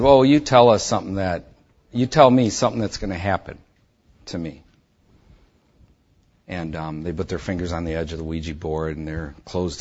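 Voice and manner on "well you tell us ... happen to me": loud, daring them to prove it; loud challenge. Retold or imitated. Calling out the girls' Ouija, loud dare. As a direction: throw down the challenge, voice raised.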